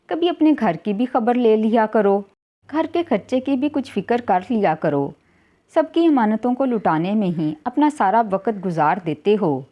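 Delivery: usual speaking voice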